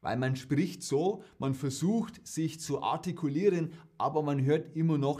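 careful High German with a Bavarian accent still showing through; Kaufland-Bavarian. Shown as a demonstration. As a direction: try for proper High German, but let the Bavarian accent leak through. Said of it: "dann nennt man das in Bayern Kaufland-Bayerisch". (bemühtes Hochdeutsch mit bayerischem Akzent)

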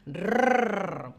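long rolled rrrr; rolled trill. Shown as a demonstration. Teacher demonstrating the Spanish double R, long rolled trill. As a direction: roll the tongue-tip R out long and strong. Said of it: "puedes exagerar la pronunciación".

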